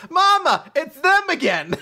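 put-on high, loud little kid; child voice. Small kid pointing them out to mama, high voice.

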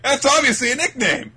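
high, loud mock indignation; upset retort. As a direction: imitate an exasperated, upset boy. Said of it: "He's so upset".

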